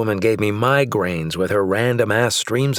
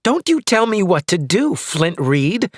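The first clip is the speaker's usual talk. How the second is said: high, loud furious rebuke; wife shouting back at husband, high and loud; louder angry wife; 9 semitones higher, 3 dB louder than usual. Acted. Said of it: "as hers grew louder".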